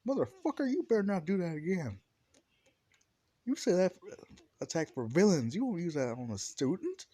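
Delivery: outraged reprimand, climbing to a yell; All Might. Acted. All Might scolds Bakugo in story.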